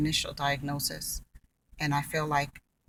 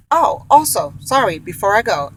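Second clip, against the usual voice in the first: sudden excited afterthought; 5 semitones higher, 11 dB louder than usual. Real, not acted. Sudden afterthought before signing off.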